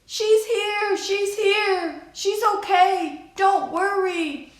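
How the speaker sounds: high, loud, calling across the street; reassuring shout. A narrator's reading. Aunt calling to the family across the crowd, high reassuring shout. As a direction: shout the good news to calm them. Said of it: "My Aunt Ida waved and shouted".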